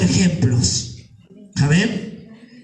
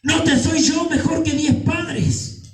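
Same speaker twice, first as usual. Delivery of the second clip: high, slow, emphatic preaching; God's insistent plea; 7 semitones higher than usual. Acted. Preacher voices God comforting those rejected by parents, high and insistent. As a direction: draw each word out at a raised pitch. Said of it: "y Dios te dice".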